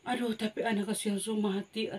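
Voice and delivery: soft, hushed lament; despairing father. Acted. Jairus softly laments his dying daughter. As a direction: breathe out the father's despair quietly. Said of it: "Yairus bilang".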